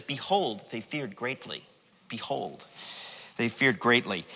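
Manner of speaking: low, hushed deadpan quote; soft, deliberately undramatic scripture reading; understated reading. Retold or imitated. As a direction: drop right down and understate the verse. Repeats the understated verse drily.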